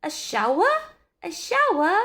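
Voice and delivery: put-on children squealing with glee; excited screaming. A narrator's reading. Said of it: "screamed Ralph's four children".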